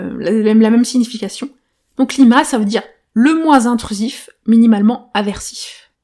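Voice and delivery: ordinary delivery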